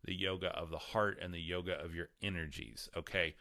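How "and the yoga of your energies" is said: ordinary talk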